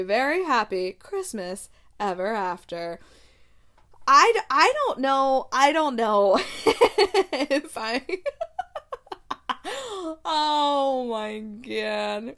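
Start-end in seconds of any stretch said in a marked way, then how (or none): 4.1-12.3 talking through loud laughter; words breaking up with laughs; laughing. Real, not acted. Laughing while trying to talk.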